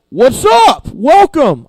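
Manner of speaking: a high, loud, chummy "What's up?"; casual God greeting. Retold or imitated. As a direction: greet like a hyped-up buddy, not a solemn God. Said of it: "God's like".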